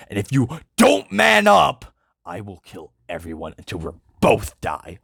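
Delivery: angry warning with one loud burst; stern Krieg. Acted. Krieg warns the soldier, angry. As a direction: threaten him, flaring up. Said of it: "Krieg yells".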